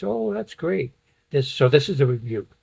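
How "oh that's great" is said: higher, lightly put-on approval; mock niceness. Teacher showing what the rebuke is not, mock-pleased. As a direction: imitate pleased praise, pitched up. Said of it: "That's not a nice".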